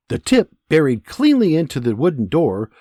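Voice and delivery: ordinary delivery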